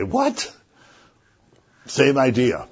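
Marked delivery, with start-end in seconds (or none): 0.0-0.5 high, astonished what; a shocked jump up in pitch; startled what. Retold or imitated. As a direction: squeak it out in disbelief. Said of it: "They say".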